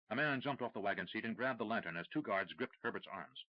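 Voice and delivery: plain reading voice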